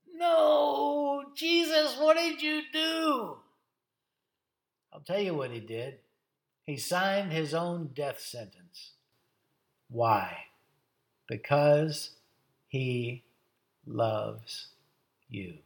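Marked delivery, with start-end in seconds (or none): 0.1-3.4 high, drawn-out dismayed cry; anguished wail. Acted. Tempting voice reacting to Jesus's answer, anguished shout. 9.9-15.6 solemn, weighted pauses. Real, not acted. Preacher telling congregation of Jesus's love, solemnly.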